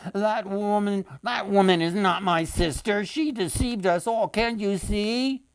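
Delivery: high, desperate protest; crying out innocence. A narrator's reading. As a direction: plead to be believed, voice up high. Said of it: "cried out his innocence".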